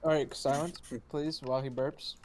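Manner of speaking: normal talking voice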